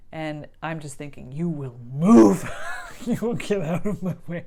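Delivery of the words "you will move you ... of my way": fierce inner command. Retold or imitated. Inner thought while in labor.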